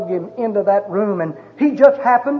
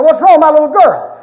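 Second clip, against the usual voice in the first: a father's raised, desperate demand, higher and louder; frantic shout; 5 semitones higher, 8 dB louder than usual. Retold or imitated. Father shouting at the doctor over his dying daughter, frantic and loud. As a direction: yell it at the doctor, frantic for your baby.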